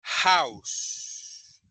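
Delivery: "house" with a strong rasped H, almost a Spanish J; clear model pronunciation. Shown as a demonstration. Teacher modelling "house" for Spanish-speaking pupils, H said like a J. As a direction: breathe the H of "house" hard, like a J. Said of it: "la pronunciación de casa es".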